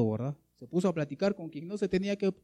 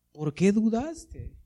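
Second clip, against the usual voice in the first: slow, raised-pitch question; reproachful question; 6 semitones higher, 4 dB louder, much slower than usual. Acted. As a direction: put it to the doubter, drawing out the words. Repeats Jesus's question to Peter.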